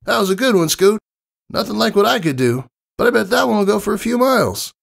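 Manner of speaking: high, boastful and fast at the end; brash tomboy pegasus. Acted. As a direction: show off playfully to the kid. Narrator voices Rainbow Dash.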